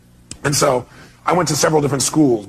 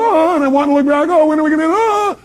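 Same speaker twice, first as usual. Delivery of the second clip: much higher, panicked cries; frightened rider sure he'll crash; hammed-up high-pitched fear; retold panic; 11 semitones higher, 3 dB louder than usual. Instructor turning his head, about to crash.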